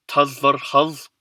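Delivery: Tazarhaz stretched out so every vowel is heard; slowly. Shown as a demonstration. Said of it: "especially slowly".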